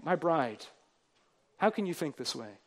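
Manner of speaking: soft, hushed plea to the bride; tender husband. Acted. God as husband tenderly asking his bride, softly. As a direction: ask her gently, almost whispered.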